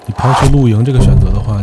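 ordinary delivery

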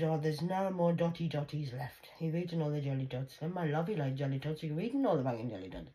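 normal talking voice

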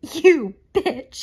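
high, loud mock outrage; hammed-up indignant accusation; Legally Blonde impression. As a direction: shriek it like a movie quote. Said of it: "reminds me of the Legally Blonde scene".

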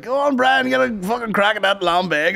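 high-pitched mock cheer; egging on. Orangemen urging the presenter to try the drum, high excited goading. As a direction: imitate a crowd goading someone on.

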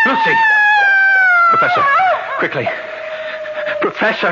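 high, loud frantic calls; panicked shout. Acted. John finding Lucy, panicked shouting for the professor. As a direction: scream for help.

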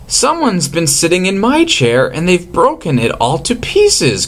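put-on high baby bear voice; a tiny cub upset about his broken chair; crying Baby Bear. A narrator's reading. Baby Bear finding his chair broken, high cry. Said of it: "cried Baby Bear".

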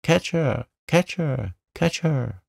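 high, squawky repeated calls; high-pitched bird cries, each falling away; parrot calling out. A narrator's reading. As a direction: call out like an alarmed parrot. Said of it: "calling out".

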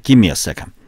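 ordinary talk

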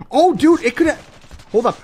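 high, loud, breaking-off exclamation; excited outburst. Real, not acted. Streamer seeing if it works at range, excited outburst. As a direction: burst out loudly, pitch way up.

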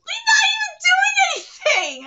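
high, loud squawk of protest; mock-outraged protest. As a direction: cry out in playful outrage for your favourite. Mock quarrel defending favourite.

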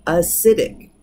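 acidic, stressed on SI, the second-to-last syllable; stress on the penultimate. Shown as a demonstration. Teacher showing the class acidic stressed on SI. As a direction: land the beat on SI in acidic. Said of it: "You can hear it on the second to the last".

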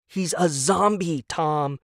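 frustrated wife calling him out; exasperated cry. Acted. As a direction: cry it out at a clueless husband. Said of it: "Cora cried".

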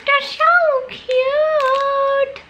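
very high, very slow drawn-out squeal; cutesy squeal. Real, not acted. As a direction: coo over something adorable. Gushing over cute cow candies.

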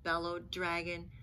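put-on expressive read-aloud for children; lively picture-book reading voice; storybook narration. A narrator's reading. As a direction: tell it like a bedtime story.

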